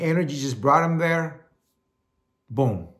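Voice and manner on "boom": ordinary talk, nothing marked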